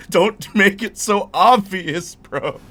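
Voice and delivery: telling a simping guest to knock it off, loud and laughing; mock exasperated. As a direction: yell at him to stop, half laughing. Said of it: "I'm just like".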